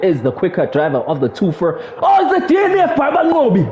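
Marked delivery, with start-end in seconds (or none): 2.0-3.7 excited shout. Real, not acted. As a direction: shout the crash news live. Car crashes out of race.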